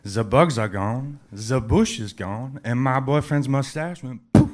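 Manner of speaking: lightly acted French lady accent. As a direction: put on a French accent, drawing out each phrase. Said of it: "The young French lady says".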